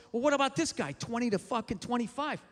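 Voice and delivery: ordinary talk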